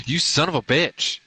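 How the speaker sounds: high, loud curse at the cat; angry yell. Real, not acted. Man yells at his little devil cat, angry.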